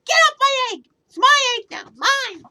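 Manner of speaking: very high, loud screeching bird; furious shriek. Acted. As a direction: shriek to claim the egg. Maisie shrieking at Horton.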